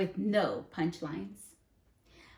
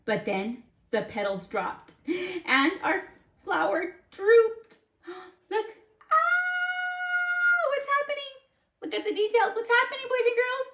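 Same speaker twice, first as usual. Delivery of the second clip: much higher, louder alarmed cries; mock alarm; 5 dB louder than usual. Teacher acting shock as the flower droops, high alarm. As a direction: squeal in sudden alarm.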